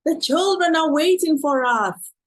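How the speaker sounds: high, loud, urgent plea to the donkey; a man urging his stubborn donkey, pleading loudly; pleading call. Acted.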